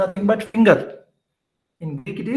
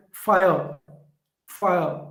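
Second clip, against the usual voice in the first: "fire" weighted on the fa, weak ending; stress on first sound. Shown as a demonstration. Teacher modelling a trochee, stress on the start of "fire". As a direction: lean on fa in "fire", let the end fall. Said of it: "fa sound is stressed more".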